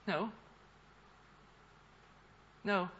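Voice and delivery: ordinary talk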